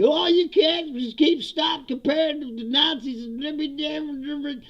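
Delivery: high, loud, whiny mock complaint; mocking whiny critics. Vlogger parodying people who object to Nazi comparisons, high and whiny. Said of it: "people say".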